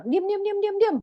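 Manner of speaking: high, loud repeated hush; stern parent hushing a child; sharp shushing. Acted. Parent shushing pushed-away child.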